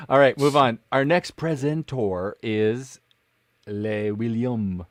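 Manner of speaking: very slow, drawn-out introduction; mock announcer. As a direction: stretch out the presenter's name for ceremony. Host introducing the next presenter.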